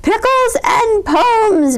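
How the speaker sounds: slow, loud, high booming proclamation; bellowing. A narrator's reading. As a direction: bellow it grandly. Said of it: "bellowed Bear".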